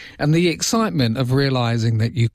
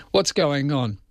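normal talking voice

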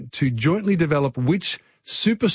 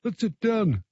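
a clumsy mock Greek accent, higher and softer; bad Greek accent; 6 semitones higher, 4 dB quieter than usual. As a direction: put on a terrible Greek accent for a moment. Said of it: "That's my Greek accent. That's terrible".

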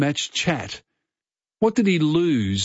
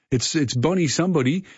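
normal talking voice

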